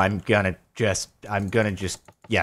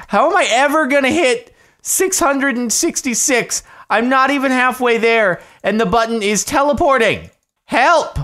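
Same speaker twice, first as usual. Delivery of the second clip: high, loud wail of frustration; frantic, high-pitched complaint building to a cry for help; exasperated yelling; 12 semitones higher, 5 dB louder than usual. Real, not acted. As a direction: yell in despair at an impossible task. Frustrated rant at impossible game task.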